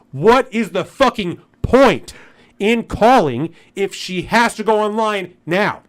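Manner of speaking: real anger boiling over; furious rant. Real, not acted. Ranting about the store clerk.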